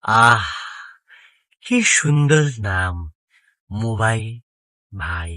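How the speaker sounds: slow, dreamy relish of the name; lovestruck, lingering with pauses; smitten savouring. Acted. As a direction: sigh it out, drawing each word long. Smitten, savouring his own name.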